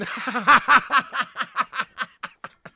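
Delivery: high, loud, helpless laughter; big amused cackle; long laugh. Real, not acted. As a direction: burst out in a long run of laughter. Amused by viewer's message.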